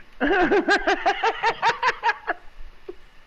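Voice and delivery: long, high peal of laughter; laughing. Real, not acted. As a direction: crack up in a rapid high cackle. Cracking up at the story.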